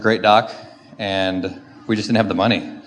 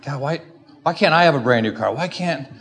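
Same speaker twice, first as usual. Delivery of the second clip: higher-pitched mock whine to God; re-enacted self-pitying protest; worked-up complaint; 5 semitones higher than usual. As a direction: retell an exasperated plea, pitched up. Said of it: "I'm, like, getting worked up now".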